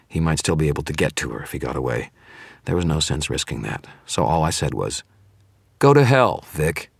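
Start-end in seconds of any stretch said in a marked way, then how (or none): 5.8-6.9 high, loud defiant retort. A narrator's reading. Wizard refusing to name anyone, defiant. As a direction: throw it at him loudly. Hero defies the villain.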